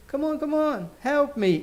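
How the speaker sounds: high-pitched mock pleading; acted appeal for help; urging plea. Acting out urging someone to help.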